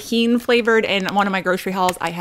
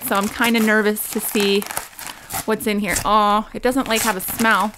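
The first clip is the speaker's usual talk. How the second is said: ordinary talk, nothing marked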